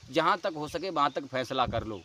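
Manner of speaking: ordinary talk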